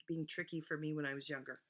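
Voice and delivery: ordinary talk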